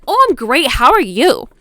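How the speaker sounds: high, loud mock-sweet greeting; fake friendly. She greets the man she will confront, fake friendly. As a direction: overdo the politeness before you pounce.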